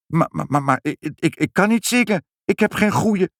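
high, stumbling panicked excuses; stammer, trying to wriggle out; high flustered stammering; nervous stammer. Acted.

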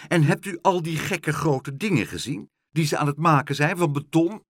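high, loud complaint; mildly outraged questioning; indignant calling out. A narrator's reading. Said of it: "riep mevrouw Helderder".

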